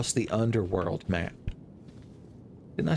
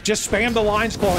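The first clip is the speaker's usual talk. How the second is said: higher and louder, urging; excited shout; 8 semitones higher, 5 dB louder than usual. Real, not acted. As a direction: spam it, yelling at yourself. Shouting mid fight.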